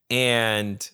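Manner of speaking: ordinary delivery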